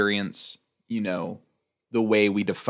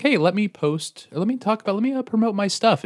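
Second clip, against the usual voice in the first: high whiny mock voice; 12 semitones higher than usual. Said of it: "when I was like".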